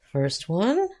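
usual speaking voice

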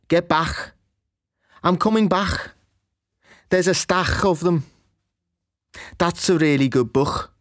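strong Scouse ck endings, a raspy back-of-throat "back"; "back" ending in a throaty Scouse ch sound; Scouse accent. Shown as a demonstration. A teacher demonstrating strong Scouse ck endings. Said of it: "C K endings are pronounced very strongly".

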